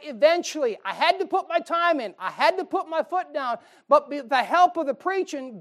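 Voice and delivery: high and loud, driving the point home; fired-up pulpit preaching; full-force preaching. Acted. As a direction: preach it hard to the congregation.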